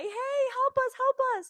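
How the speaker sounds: high, loud yell for help across the water; calling for help. Retold or imitated. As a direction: shout it out to a distant boat. Waving down a passing boat.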